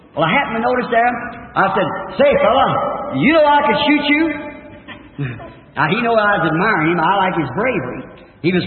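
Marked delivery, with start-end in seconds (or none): 2.2-4.2 loud, high-pitched taunting call; calling out challenge. Retold or imitated. Hunter challenging an old eagle, calling out. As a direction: call it out high to test the bird.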